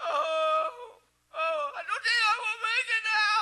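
loud, high mock moaning; comically exaggerated groaning complaint; Virgil faking illness. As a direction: wail like a hammed-up dying patient. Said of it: "he'd act like he's having appendicitis".

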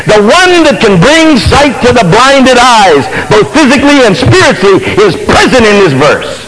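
emphatic, high-pitched preacher's proclamation; loud preaching. Real, not acted. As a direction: proclaim it to the congregation, stretching the key phrases. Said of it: "George didn't say it nearly that loud".

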